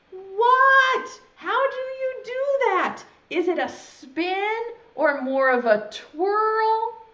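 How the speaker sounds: high-pitched astonished questions; flustered caterpillar. Acted. Caterpillar asks friend how to build a chrysalis, flustered. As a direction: gape in disbelief, pitch way up.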